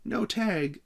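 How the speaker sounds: very high, loud disbelieving cry; outraged cry. A narrator's reading. Frank protesting the referee's call, a high outraged cry. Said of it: "cried Frank".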